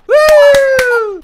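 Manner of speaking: loud, high "woo"; excited whoop. Real, not acted. Player cheering a basket, shrill excited whoop. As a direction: let out a high celebratory hoot. Celebrating throwing the baby.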